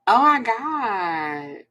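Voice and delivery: long drawn-out exasperated exclamation. Real, not acted. Reacting to viewers about a stranger's messages, exasperated. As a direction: stretch it out slowly in sheer disbelief.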